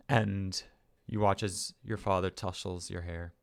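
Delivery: ordinary talk, nothing marked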